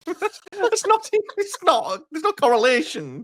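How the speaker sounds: high-pitched, laughing protest; laughing disbelief. Real, not acted. Co-host cracking up at the engineer's nonsense answer, laughing in disbelief. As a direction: protest through real laughter.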